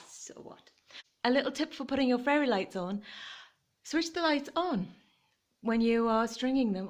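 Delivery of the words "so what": whispered. Real, not acted. Defiant aside about dark lights.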